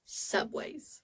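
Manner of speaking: breathy whisper of one word; hushed announcement; whispered title. Acted. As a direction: whisper it like a secret reveal.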